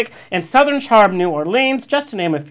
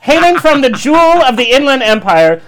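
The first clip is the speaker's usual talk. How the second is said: grand entrance announcement; 4 semitones higher, 7 dB louder than usual. Acted. Host's grand introduction line.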